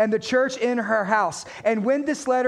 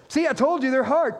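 ordinary delivery

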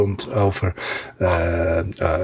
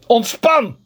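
higher, louder barked order; shouted command; 11 semitones higher, 4 dB louder than usual. Shown as a demonstration. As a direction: yell relax like a drill sergeant.

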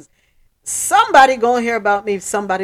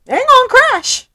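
squealed aside; 9 dB louder than usual. Real, not acted. Sudden high squeal.

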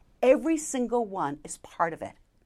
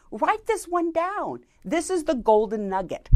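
firm directive; 7 semitones higher than usual. Shown as a demonstration. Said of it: "and giving them a directive".

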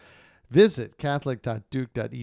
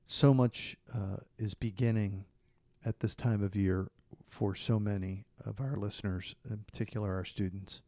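prayer; 2 semitones lower, 5 dB quieter than usual. Acted. Leads closing prayer.

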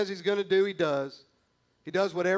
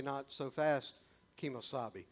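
low mock Lone Ranger aside; 5 semitones lower, 9 dB quieter than usual. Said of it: "And I would say".

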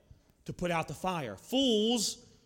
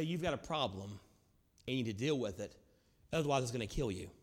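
softer, lower, tender warning; gentle loving rebuke; 6 semitones lower, 6 dB quieter, faster than usual. Retold or imitated. Friend gently confronting you, soft and tender. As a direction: lovingly tell a friend the hard truth. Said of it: "in a very loving manner".

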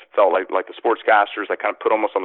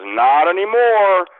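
high, drawn-out mock put-down; mocking crew member; 8 semitones higher, 3 dB louder, much slower than usual. Camera guy telling the actor he lost his lines, mocking. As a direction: stretch it out slowly with raised pitch.

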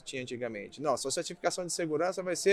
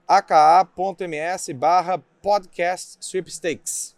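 slow, louder, clearly separated dictation; 7 dB louder, much slower than usual. Shown as a demonstration. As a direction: read the address slowly and clearly. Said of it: "Vou repetir, então, tudo direto".

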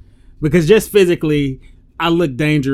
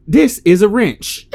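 slow, firm and higher, spelling it out; 7 semitones higher, 5 dB louder, slower than usual. Shown as a demonstration. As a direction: talk to a clueless customer like a child. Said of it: "I gotta be firm with some people".

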